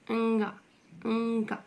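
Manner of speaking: अंगा opening on a held nasal hum; isolated nasal ending अंगा; mouth-and-nose sound. Shown as a demonstration. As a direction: hum the अं through the nose before गा. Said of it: "मुंह से भी निकल रहा है और नाक से भी".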